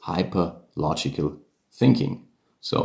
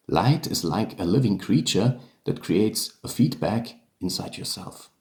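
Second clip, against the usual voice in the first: ordinary talk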